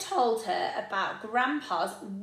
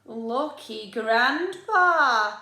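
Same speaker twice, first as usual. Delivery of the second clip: louder, very slow dry reply; wry Mum; 8 dB louder, much slower than usual. Acted. Mum humouring Oliver, louder and slow. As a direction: humour him gently. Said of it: "said Mum".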